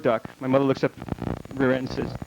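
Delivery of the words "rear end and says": ordinary talk, nothing marked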